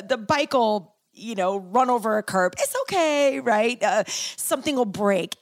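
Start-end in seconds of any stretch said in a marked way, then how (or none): 2.6-3.4 put-on cute little kid voice. Imitating her friend's son soothing after spills, cute little voice. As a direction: imitate a sweet seven-year-old soothing everyone. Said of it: "he has this cute little voice".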